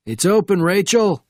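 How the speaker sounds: high, loud call through a door; shouting. A narrator's reading. Sergeant inside answering a knock, shouted call. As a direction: shout to someone outside. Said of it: "shouted the voice from within".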